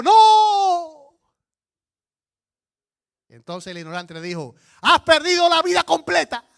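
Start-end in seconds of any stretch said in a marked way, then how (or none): 0.0-1.2 high, loud shouted no; panicked no. Acted. Wise man shouting back in the storm, high and loud. 4.8-6.5 high, loud shouted verdict; triumphant punchline. Acted. Ignorant man shouting at the wise man, high and loud.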